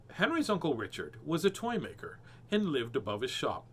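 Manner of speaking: ordinary delivery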